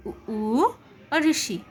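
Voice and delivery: "उ, ऊ और ऋषि" drawn out very slowly, mouth nearly closed; slow closed vowel demo. Shown as a demonstration. Teacher asking students to pronounce along, very slow. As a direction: draw out each closed vowel for students to copy. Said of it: "आप उच्चारण करके देखिए".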